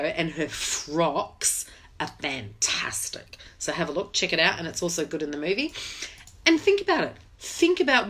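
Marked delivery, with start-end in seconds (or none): 0.0-3.4 slow, breathy whisper of delight; whispered aside. Real, not acted. Gushing to viewers about [name]'s dresses, a slow, secret whisper. 6.4-8.1 urging. Real, not acted. Urging viewers.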